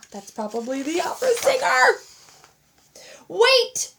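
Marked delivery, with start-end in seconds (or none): 3.3-3.9 high, loud shout; boy shouting at the closing door. A narrator's reading. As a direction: yell to stop the elevator. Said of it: "shouted Edward".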